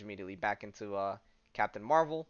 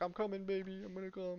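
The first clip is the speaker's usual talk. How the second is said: put-on higher, sloppy drunk guy voice; 9 semitones higher, 6 dB quieter than usual. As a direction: play the drunk boyfriend lurching in, pitched up. Voicing drunk Josh.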